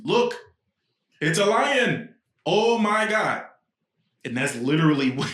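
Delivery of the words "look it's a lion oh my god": high, loud, drawn-out cry of wonder; mock amazed exclamation. Comedian translating the Lion King chant, mock-amazed and loud. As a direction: belt out mock awe, stretching the last words. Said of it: "He was like, "It means".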